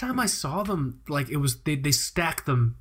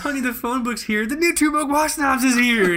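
excited call; 10 semitones higher, 4 dB louder than usual. Pretend. Excited family member calling out.

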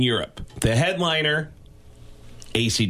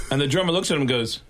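ordinary talk

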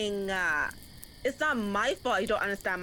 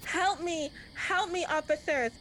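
high, hammed-up cries for help; faking terror for arriving officers; fake damsel in distress; 7 semitones higher than usual. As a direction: play the helpless victim for the cops. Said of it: "brilliant acting".

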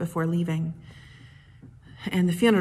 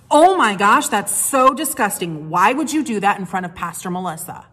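mock outrage; 2 semitones higher, 8 dB louder than usual. Dying mother mock-scolding her son in front of the pastor, loud playful outrage. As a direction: play up the fake disgust to embarrass him.

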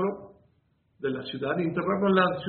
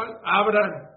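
loud order; 5 semitones higher, 8 dB louder than usual. Acted. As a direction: bark the command to open it. Policeman orders again.

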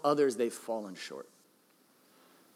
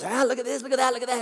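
high, louder proud exclaiming; excited boast; 10 semitones higher, 4 dB louder, faster than usual. Retold or imitated. Marlin boasting to his wife, high and excited. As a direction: show it off like a proud husband. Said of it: "he goes".

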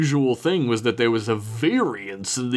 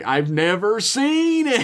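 mock indignant retort; 9 semitones higher than usual. Retold reply to arguing audience.

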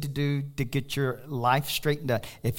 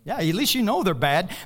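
ordinary talk

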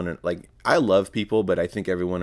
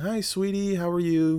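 put-on high, sugary hello to a little girl; sweet greeting to a child; 10 semitones higher than usual. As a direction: put on a nice voice for the kid. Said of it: "you know, I'm nice".

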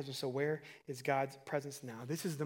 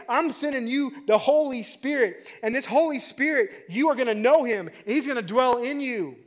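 loud, high pulpit proclamation; punched, ringing sermon climax; full-force preaching; 8 semitones higher, 12 dB louder than usual. Real, not acted. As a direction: proclaim it forcefully to the whole room.

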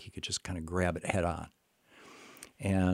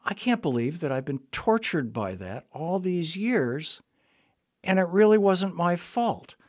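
high, slow, louder cry of relief; relieved outburst; 10 semitones higher, 5 dB louder than usual. Retold or imitated. Veteran telling the therapist it wasn't his fault, high and loud. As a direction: burst out with years of relief. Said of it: "And he said".

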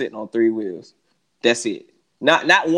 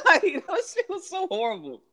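laughing. Real, not acted. Laughing complaint.

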